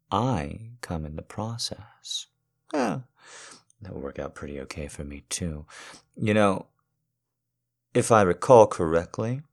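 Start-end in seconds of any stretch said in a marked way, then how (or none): 6.2-9.4 louder, playful and teasing. Acted. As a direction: announce it brightly. Loud playful announcement of a recollection.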